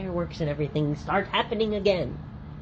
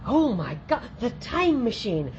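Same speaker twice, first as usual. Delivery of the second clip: mock awe; 6 semitones higher than usual. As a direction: marvel at it like a kid.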